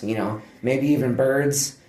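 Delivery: normal talking voice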